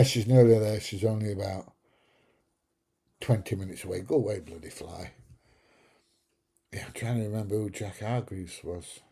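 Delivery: normal talking voice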